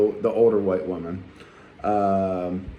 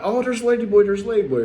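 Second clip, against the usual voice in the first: surprised tourist; 9 semitones higher, 3 dB louder than usual. Retold or imitated. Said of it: "he's surprised".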